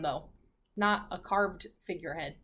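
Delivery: plain reading voice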